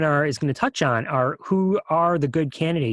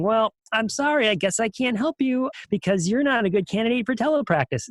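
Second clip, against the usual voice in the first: higher-pitched imitation of a feeble excuse; mock apology; 8 semitones higher than usual. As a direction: mimic someone apologetically turning a client away. Mimics clinicians' excuse for refusing.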